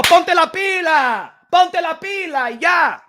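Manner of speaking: slow, hammered-out order; slow emphatic command. Real, not acted. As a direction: spell each word out like a stern command. Telling people to get to work.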